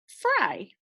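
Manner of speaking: light high echo of fry; quick high repeat. Shown as a demonstration. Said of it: "let's read this word together".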